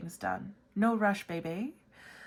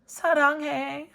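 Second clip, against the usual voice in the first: quick, much higher, cutesy I love you; cute sign-off; 8 semitones higher, 5 dB louder, much faster than usual. Acted. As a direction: chirp it sweetly. Signs off letter cutely.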